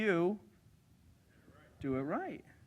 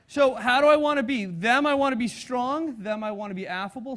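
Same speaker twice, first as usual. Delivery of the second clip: ordinary talk, nothing marked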